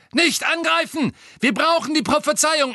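high, loud commanding cries; urgent shouting. A narrator's reading. Malfoy stopping the Death Eaters' attack, shouting. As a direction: yell the order over the noise. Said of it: "die Rufe von Malfoy".

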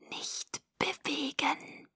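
very soft, slow whisper; velvet whisper. A narrator's reading. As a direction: whisper the warning, drawn out. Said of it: "flüsterte die Samtstimme". (samtiges, langsames Flüstern)

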